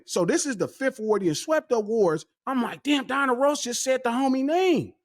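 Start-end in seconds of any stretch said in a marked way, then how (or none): 2.8-4.9 retold excited screaming. Fan seeing [name] announce his friend, retold excitement. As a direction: relive the thrill in a raised pitch. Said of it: "I'm screaming".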